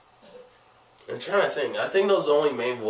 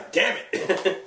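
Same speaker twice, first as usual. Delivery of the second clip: higher, exasperated outburst after a cough; 7 semitones higher than usual. Real, not acted. Cold sufferer cursing an interrupting cough, exasperated. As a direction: curse the cough that interrupted you.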